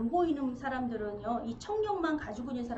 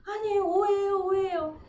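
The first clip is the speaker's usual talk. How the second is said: pleading denial; 6 semitones higher, 5 dB louder than usual. Acted.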